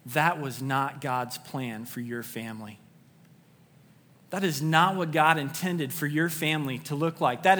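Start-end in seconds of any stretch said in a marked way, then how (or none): 0.1-2.8 slow, lower-pitched, each word given room; clear, deliberate statement. Real, not acted. Preacher reassuring hurting families, slow and clear. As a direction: say it clearly and slowly, letting the pitch sink. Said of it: "hear me say clearly".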